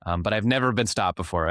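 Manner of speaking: usual speaking voice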